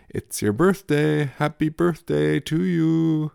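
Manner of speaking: put-on slow, high singsong kid; toddler voice. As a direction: croon it like a sweet little boy. Toddler quote in story.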